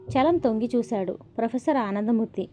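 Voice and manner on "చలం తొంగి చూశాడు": ordinary delivery, nothing marked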